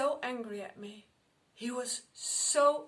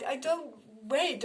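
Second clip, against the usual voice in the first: ordinary talk, nothing marked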